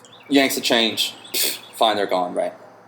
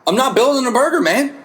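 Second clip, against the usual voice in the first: worked-up rant; 9 semitones higher than usual. Real, not acted. Bartender ranting about building his own burger, high exasperated. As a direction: vent at the waiter, fired up.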